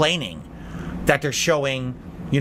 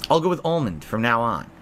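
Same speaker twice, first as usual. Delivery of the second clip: usual speaking voice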